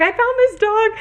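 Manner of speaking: high-pitched retold exclamation; excited outburst. Telling Sam on the roadside why she stopped, retold excited outburst. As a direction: blurt it out very high, re-enacting the moment.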